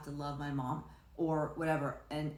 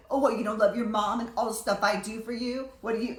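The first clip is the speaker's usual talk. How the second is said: higher, louder indignant scolding; mock guilt-tripping parent; 6 semitones higher, 8 dB louder than usual. Imagined mother to unloving child, loud mock indignation. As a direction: imitate an affronted mother laying on guilt.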